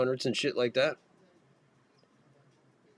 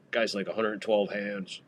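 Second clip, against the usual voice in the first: ordinary delivery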